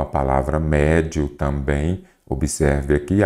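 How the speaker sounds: ordinary delivery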